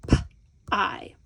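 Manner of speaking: P-I split into a bare puff "p" and "eye"; sounded out. Shown as a demonstration. Teacher sounding out the first syllable, sound by sound. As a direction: sound P-I out with a gap between. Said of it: "How do we sound that out?".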